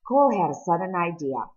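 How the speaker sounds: plain reading voice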